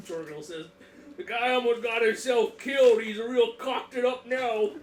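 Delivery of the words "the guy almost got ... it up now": put-on louder, higher, slow muddled British accent. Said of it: "why Jorgenel has that accent".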